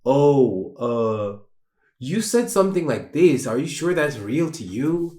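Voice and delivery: a light mock probing questioner; questioning voice. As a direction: ask it like a curious interviewer. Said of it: "it's not new to me to be like".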